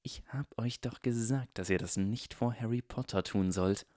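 hushed, sharp whisper. Acted. Draco whispers a rebuke to his henchmen. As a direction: hiss the reproach under the breath.

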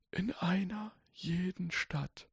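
put-on whispered, high, soft old wizard recitation; softly reciting old wizard. A narrator's reading. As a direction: recite it gently as an old sage. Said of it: "rezitierte der alte Zauberer sacht".

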